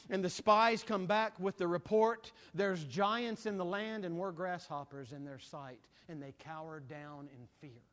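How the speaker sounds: loud, soaring preacher's cadence; preaching at full force. Acted. Preacher rousing his congregation, full force preaching. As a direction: preach it to the back pew.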